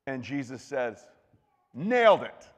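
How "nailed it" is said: a punchy, higher, louder nailed it; emphatic approval. Acted. Jesus cheerfully tells the expert he got it, punchy. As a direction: land it like a cheerful high five.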